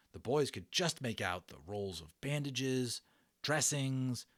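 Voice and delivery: plain reading voice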